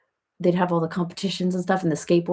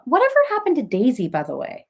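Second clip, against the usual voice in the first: usual speaking voice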